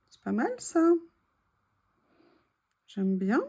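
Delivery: ordinary talk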